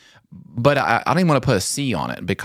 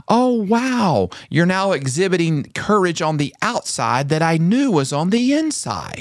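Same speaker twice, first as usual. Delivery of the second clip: much higher, admiring exclaim; delighted praise; 6 semitones higher than usual. Retold or imitated. Mother praises her son, delighted and high. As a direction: marvel at your son's courage. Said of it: "Rayleigh said these words".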